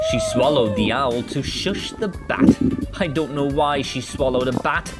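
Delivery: ordinary delivery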